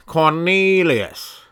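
high, loud, drawn-out grand announcement; fancy name. Acted. Comic announces an unlikely hobo name, hammed-up grand.

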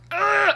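loud, high strangled groan; disgusted ugh groan. Retold or imitated. Chest tightening at chewing, a loud high groan. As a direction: let the tension squeeze out in a groan. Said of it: "my chest tightens up".

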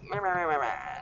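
mock babble of meh-meh sounds, sliding down at the end; imitating restless son. Mom mimicking her ADHD son at homework, mock babble. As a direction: babble nonsense like a hyper kid. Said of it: "he's, like, all over the place".

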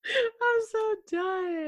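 high, drawn-out wail through laughter; so tickled it comes out as a long squeal; real breathless giggly whine; helpless laughter. Real, not acted. Overcome with laughter at joke.

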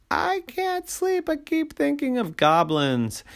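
high-pitched bedtime complaint; whining child. Acted. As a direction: whine like a sleepless little girl. Sleepless child complains to Daddy.

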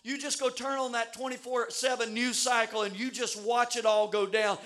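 ordinary talk, nothing marked

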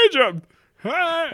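high jittery nervous voice; Don Knotts. Acted. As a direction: fluster it out, high and squeaky. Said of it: "like Don Knotts".

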